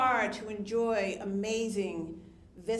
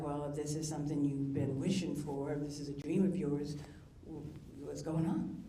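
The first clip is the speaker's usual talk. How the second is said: lightly voiced father, lower and gentle; a hint of dad; 7 semitones lower, 4 dB quieter than usual. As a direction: speak as the late father, calmly questioning. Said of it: "And he was like".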